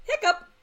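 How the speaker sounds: sudden high yelp of Hiccup; hiccup squeak. Pretend. Acting out a hiccup.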